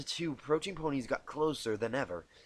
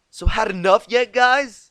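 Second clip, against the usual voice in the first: much higher, much louder taunting call; 10 semitones higher, 16 dB louder than usual. Acted. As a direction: shout across the snowfield. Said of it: "Rainbow Dash's voice called out again".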